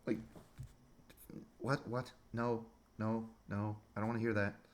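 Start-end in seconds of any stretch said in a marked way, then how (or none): none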